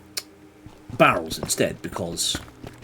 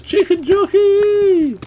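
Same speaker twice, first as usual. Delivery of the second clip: very high, slow, loud cheer; crowd shout; 11 dB louder, much slower than usual. Retold or imitated. Echoing the film audience's loud chant. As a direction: yell it like a cinema crowd. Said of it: "and everybody goes".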